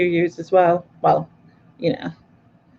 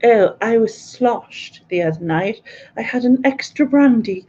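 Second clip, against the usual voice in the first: mock posh accent; 3 semitones higher than usual. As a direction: act like a tipsy toff with a posh accent. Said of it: "you posh people say sloshed".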